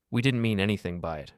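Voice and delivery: ordinary delivery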